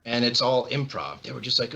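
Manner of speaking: usual speaking voice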